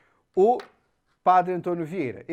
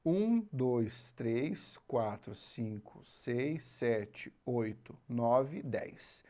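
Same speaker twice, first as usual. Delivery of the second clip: softer counting, fading almost to a whisper on "dez"; 4 semitones lower, 8 dB quieter, much slower than usual. Shown as a demonstration. Teacher quietly counting poetic syllables to ten. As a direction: count the syllables under your breath. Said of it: "Você conta até a última sílaba tônica".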